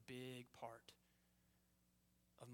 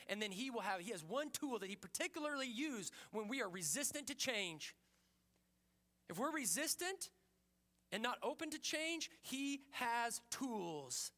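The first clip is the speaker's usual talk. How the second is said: raised, driving pulpit voice; preaching at full force; 9 semitones higher, 6 dB louder than usual. Acted. Pastor challenging the congregation, in a loud preaching voice.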